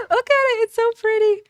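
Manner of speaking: high, bright delight at the new place; excited. Real, not acted. Gamer showing viewers the new apartment, delighted. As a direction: gush over the apartment.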